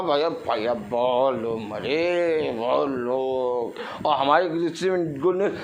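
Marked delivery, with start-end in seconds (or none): 0.0-3.7 slow, drawn-out, lower mock wail; mock lament. News reader telling brother that many died, mock lamenting. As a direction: drag out the bad news like a mourner. Acts out bearer of bad news.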